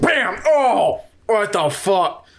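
jump, then play it cool; startled orc. Acted. Orc at the safe caught off guard, startled then casual. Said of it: "he's like".

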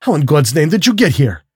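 high-pitched, mildly screamed question; astonished outcry. A narrator's reading. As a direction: demand it in shocked disbelief. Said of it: "screamed the general".

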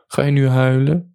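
mocking question. A narrator's reading. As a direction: taunt them lightly for crying. Mocking a tearful boyfriend. (spottend, hoog vragend)

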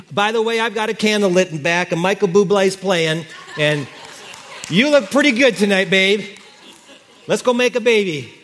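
hammed-up flirting husband. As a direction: ham up the smooth husband. Preacher plays flirting husband.

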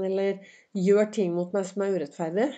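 ordinary talk

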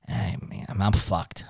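defeated. Shown as a demonstration. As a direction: let the words sink, beaten and quiet. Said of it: "when you are defeated".

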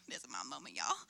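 low, soft under-the-breath excitement; hushed aside. Retold or imitated. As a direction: confide it low and quiet to the crowd. Retold inner thought during prophecy.

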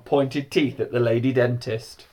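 usual speaking voice

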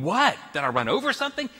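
put-on high, puzzled retelling of a startled kid; startled, bewildered. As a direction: act out baffled alarm. Acting out his startled younger self.

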